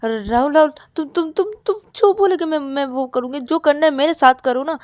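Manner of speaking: stammering, repetitive begging; desperate pleading. Acted. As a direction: plead anxiously, tripping over every phrase. Ravi begs Rahul to stay. (घबराई, हकलाती हुई मिन्नतें)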